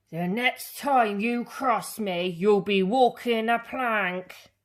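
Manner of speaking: captain roaring a threat at Pete. A narrator's reading. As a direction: threaten Pete like an angry pirate. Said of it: "roared the captain".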